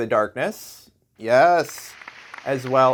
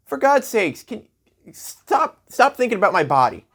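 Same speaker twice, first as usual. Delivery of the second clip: high-pitched exasperated plea; mock exasperated; 7 semitones higher than usual. Comedian begs lawmakers to leave them alone, mock exasperated. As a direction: protest in hammed-up exasperation, pitch jumping up. Mock outrage at nudity ban.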